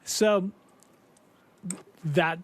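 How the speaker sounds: normal talking voice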